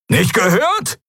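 high-pitched acted yell; roaring. A narrator's reading. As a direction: bellow it at the person beside you. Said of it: "brüllte Kero neben ihr". (hoch gebrüllte Frage)

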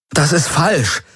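high, upset cry; exclaiming. A narrator's reading. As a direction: call it out in disbelief. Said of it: "rief er aus".

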